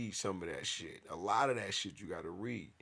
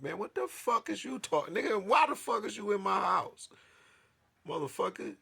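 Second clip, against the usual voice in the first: furious shout; 13 semitones higher, 10 dB louder than usual. Retold or imitated. Retold outrage at intruding cops.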